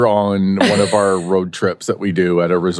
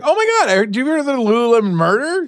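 high excited gush; faster than usual. Retold or imitated. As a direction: blurt it out, high and fast. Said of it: "I was like".

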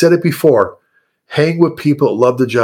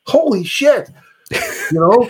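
high, thrilled outburst; excited exclaim; 11 semitones higher, much slower than usual. Acted. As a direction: burst out in delight.

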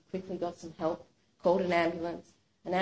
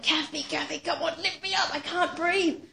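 desperate; 7 semitones higher than usual. Retold or imitated. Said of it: "she was desperate".

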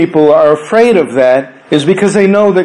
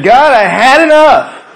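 Elijah's cry; 7 semitones higher, slower than usual. Acted. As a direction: cry out to God, worn out. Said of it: "And you cry out like Elijah did".

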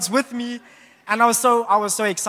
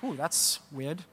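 mild surprise; 4 semitones lower, 9 dB quieter, slower than usual. Retold or imitated. Surprised by phone notification.

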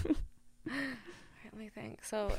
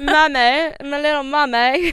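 louder, British "mummy"; mock British mummy; 5 semitones higher, 12 dB louder than usual. Joking British baby talk to her cohost, mock British accent. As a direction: tease in a silly British voice. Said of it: "gonna try to be British".

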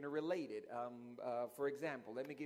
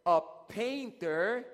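drawn-out prompt; 8 semitones higher, 4 dB louder, much slower than usual. Real, not acted. Cue for congregation to answer.

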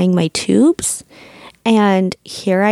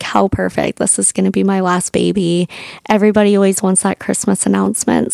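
normal talking voice

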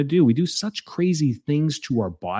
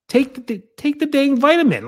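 high-pitched, exasperated order; exasperated scolding; 13 semitones higher, 4 dB louder than usual. Real, not acted. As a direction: scold a fussy complainer, voice pitched up. Exasperated scolding of picky complainers.

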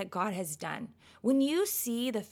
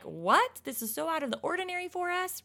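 surprised wonder; 7 semitones higher than usual. Retold or imitated. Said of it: "we're like".

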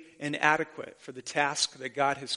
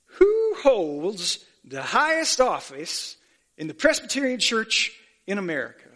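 a lightly voiced old professor, raised and slowed right down; 4 semitones higher, 4 dB louder than usual. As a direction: lean on each word like a teasing riddle. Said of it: "And he said to us".

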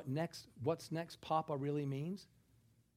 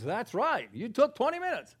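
lightly voiced inner accuser, rising higher; louder, higher mock voice of the mind; nagging inner mind; 9 semitones higher, 8 dB louder than usual. The mind answering a worried man about his body, mildly accusing. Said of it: "your mind goes".

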